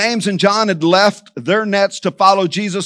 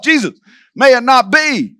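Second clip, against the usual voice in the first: higher-pitched, emphatic protest; rebuke; 5 semitones higher, 4 dB louder than usual. Acted. Peter rebuking Jesus, an emphatic protest. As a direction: object firmly, pitched up. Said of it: "he rebukes Jesus himself".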